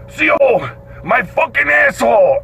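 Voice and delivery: high, whining yell of complaint; inmate hollering. Retold or imitated. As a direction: wail it at the guard. Screaming inmate complaining to guard.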